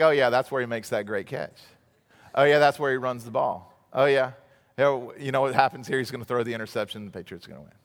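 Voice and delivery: low, soft and fast, totally unbothered; bored replay watcher. Retold or imitated. Father and son watching the replay, flat and unconcerned. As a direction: toss it off like you already know the ending. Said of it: "we're like".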